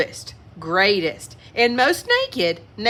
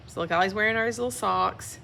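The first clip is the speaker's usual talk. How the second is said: fast, soft chuckling aside; soft quick aside; 7 dB quieter, much faster than usual. Real, not acted. Reader pointing out the socks, soft and fast. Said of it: "aside about picture".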